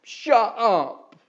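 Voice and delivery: loud high yell. Acted. Eric yelling at Gribley, a loud high yell. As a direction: bellow it to shut them up. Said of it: "he yelled".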